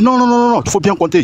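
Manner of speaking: quick high protest from the cashier; cashier's retort. Retold or imitated. Cashier telling the angry client to recount. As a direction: mimic the cashier brushing him off. Cashier refusing, retold quarrel.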